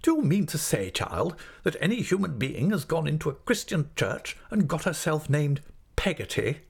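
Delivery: high, incredulous interrogation; indignant. Acted. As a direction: demand it in affronted disbelief. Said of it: "with some indignation".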